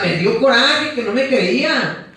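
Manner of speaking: ordinary talk, nothing marked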